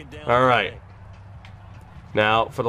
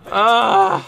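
high, loud cry of frustration; frustrated groan-cry; 8 semitones higher, 4 dB louder than usual. Real, not acted.

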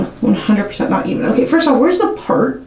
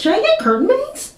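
much higher, playful self-question; high teasing question; 12 semitones higher than usual. Real, not acted. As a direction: tempt yourself playfully. Teasing herself.

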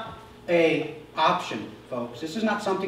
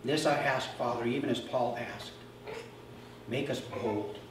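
soft, lower, reverent prayer voice; closing prayer; 3 semitones lower, 7 dB quieter than usual. Acted. Pastor leading the congregation in a closing prayer, hushed reverent. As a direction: pray quietly over the church.

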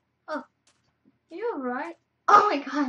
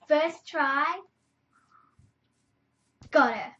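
ordinary talk

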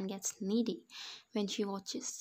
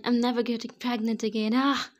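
loud scream ending in a cry of pain; screaming in labour; 2 semitones higher, 9 dB louder than usual. A narrator's reading. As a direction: scream it out mid-push. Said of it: "She screamed".

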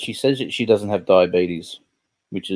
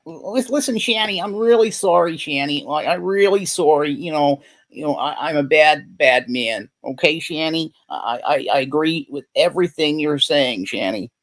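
much higher, pleading, hammed-up apology; mock apology; 7 semitones higher than usual. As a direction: grovel like a sorry husband, over the top. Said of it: "what Jason should have done here is went".

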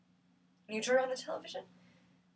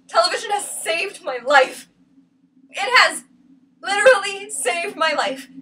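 loud, slow, emphatic defence; loud, heated declaration; impassioned insistence; 4 semitones higher, 6 dB louder, slower than usual. Acted. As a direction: raise the voice and stretch each word.

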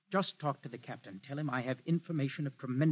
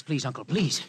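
pressing, pleading and a little louder; urgent plea; 3 dB louder than usual. Acted. Hoyland begs his uncle at the door, urgent and pleading. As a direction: beg your uncle with real urgency. Begging uncle to let him in.

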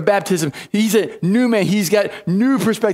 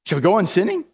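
ordinary talk

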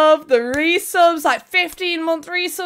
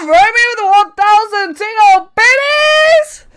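loud, rising hype shout; thrilled announcement; 5 semitones higher, 7 dB louder than usual. Real, not acted. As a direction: build to a high, excited yell. Announces a big donation.

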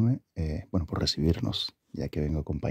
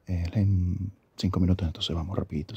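normal talking voice

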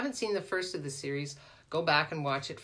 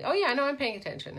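distracted fake listener; 8 semitones higher, 3 dB louder, faster than usual. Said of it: "they're talking like this".

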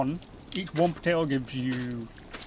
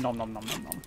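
mock munching; 5 semitones lower, 5 dB quieter than usual. Mock eating as the character feeds.

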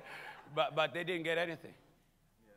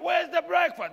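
much higher, louder hungry yell; mock shout; 12 semitones higher, 11 dB louder than usual. Preacher acts a family waking to find breakfast gone, loud shout. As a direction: yell for breakfast like a startled child. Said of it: "you wake up".

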